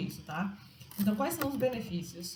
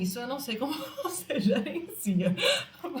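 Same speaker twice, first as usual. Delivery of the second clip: laughing; 4 semitones higher than usual. Real, not acted. Laughing at own remark.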